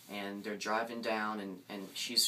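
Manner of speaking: ordinary delivery, nothing marked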